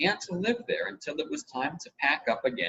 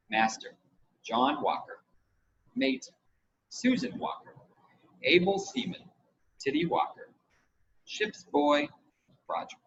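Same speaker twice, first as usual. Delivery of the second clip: reading out the crew list; 9 semitones higher than usual. A narrator's reading. John reads out the crew list, lightly formal. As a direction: read each name like an official record. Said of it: "he wrote".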